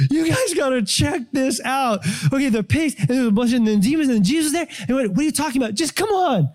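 high-pitched excited babble, acted; freaking out. Witnesses run into town urging people to come see, freaking out. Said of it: "and they're freaking out".

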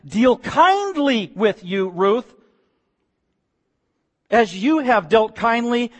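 lifted, drawn-out blessing; fervent prayer. Acted. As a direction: pray it out slowly with fervour. Preacher voicing Naomi's fervent prayer.

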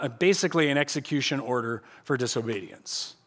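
normal talking voice

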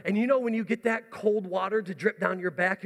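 usual speaking voice

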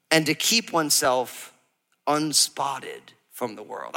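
slow, measured Bible reading; scripture reading. Acted. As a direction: read the verse slowly and solemnly. Reads scripture aloud.

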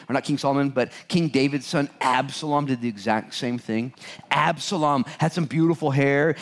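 usual speaking voice

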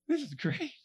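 tiny high-pitched glee; mock giddy squeak; high squeaky delight. His delighted private reaction, retold.

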